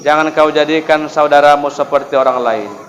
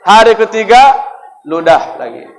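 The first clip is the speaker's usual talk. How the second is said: ordinary talk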